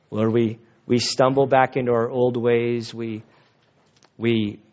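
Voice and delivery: low, subdued congregational prayer. Real, not acted. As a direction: lead them in prayer, pitched low and even. Leads a closing prayer.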